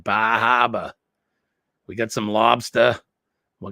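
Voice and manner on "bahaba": drawn-out playful whoop, a touch fuller; mock party whoop. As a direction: say it like you mean it. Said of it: "Say it like you mean it".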